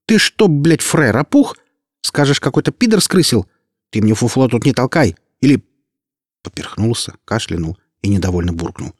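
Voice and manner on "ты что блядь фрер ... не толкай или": higher, louder thuggish threat; a hint of a gangster threatening a servant; threatening thug. A narrator's reading. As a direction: lean in and menace him. Nark threatens the warrior.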